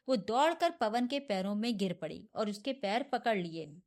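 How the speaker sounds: plain reading voice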